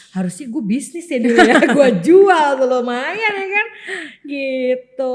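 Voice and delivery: half-crying with laughter at her missed chance; laughing. Real, not acted. To her cohost about selling snails, laughing hard. Joking she should have sold snails.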